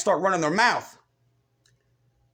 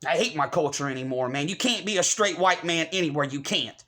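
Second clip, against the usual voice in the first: ordinary delivery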